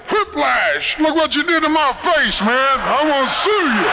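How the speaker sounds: pitched well up, blustering at the driver; mock threat. To the angry driver behind him, a high mock threat. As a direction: bluff him with a fake lawsuit.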